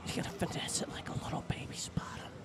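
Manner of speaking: whispered commentary; whispering. Real, not acted. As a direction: hush it like golf.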